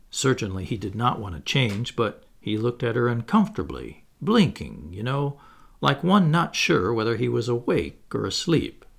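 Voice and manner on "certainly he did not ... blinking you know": ordinary delivery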